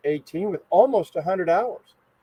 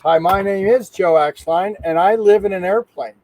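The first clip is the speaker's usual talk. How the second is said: loud intro; 4 semitones higher, 7 dB louder than usual. Real, not acted. Big self-introduction on camera.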